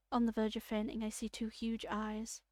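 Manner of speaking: normal talking voice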